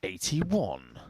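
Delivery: deep, drawn-out darts caller call; referee's voice. Pretend. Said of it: "You carry on saying numbers in referee's voice".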